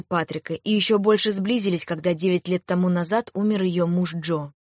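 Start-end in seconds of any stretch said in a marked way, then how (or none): none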